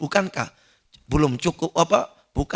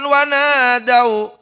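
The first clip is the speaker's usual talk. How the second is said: slow, high, loud cry for help; pleading shout; 6 semitones higher, 7 dB louder, much slower than usual. Acted. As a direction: call out desperately, stretching it. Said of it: "berteriak-teriak merayu".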